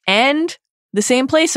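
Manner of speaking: "place" rises high and slow; rising dramatic stress. Acted. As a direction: draw out "the same place", lifting "place". Climax of repeated same place.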